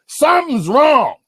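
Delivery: slow, high, loud cry of alarm; distressed outburst. Real, not acted. Man whose friend can tell by voice, loudly distressed.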